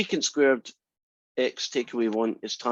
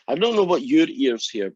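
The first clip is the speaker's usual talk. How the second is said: ordinary delivery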